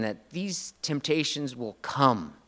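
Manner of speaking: normal talking voice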